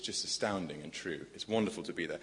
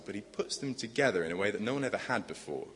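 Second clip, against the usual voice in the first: usual speaking voice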